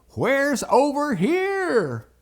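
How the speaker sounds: high, loud, stretched-out holler; calling out. Retold or imitated. Daddy tries to locate his boys, hollering. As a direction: call it slowly across a distance.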